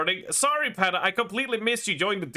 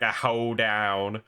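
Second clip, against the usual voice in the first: slow, low Southern accent; 6 semitones lower, slower than usual. Shown as a demonstration. Showing how Southerners say stock words, exaggerated Southern accent. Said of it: "this is how they pronounce it".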